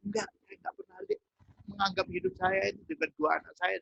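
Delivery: usual speaking voice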